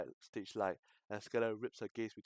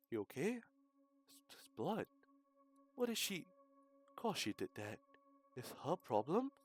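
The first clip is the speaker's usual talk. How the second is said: crowd voices; 6 semitones higher than usual. Acted.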